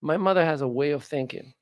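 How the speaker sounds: normal talking voice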